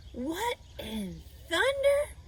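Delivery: slow, high, puzzled exclamation; startled exclamation. A narrator's reading. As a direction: draw it out in disgusted surprise. Lurvy smells the stink.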